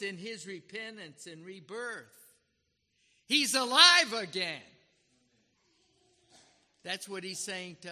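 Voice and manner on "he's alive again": loud, high triumphant declaration; joyful proclamation. Acted. Preacher proclaiming the lost son alive to the congregation. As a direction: shout the good news out.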